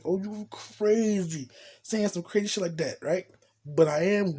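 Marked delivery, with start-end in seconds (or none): none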